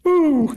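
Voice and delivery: a high, loud cracked woo, right on the verge of tears; high voice-breaking whoop. Acted. Character choking up to the host, a loud, high, teary whoop. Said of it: "like he's right on the verge of tears".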